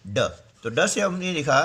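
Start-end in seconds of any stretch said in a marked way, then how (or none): none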